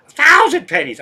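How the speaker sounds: high loud cartoon yelp; Sparky's voice. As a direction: fling it up high and loud, gleeful. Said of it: "but I say".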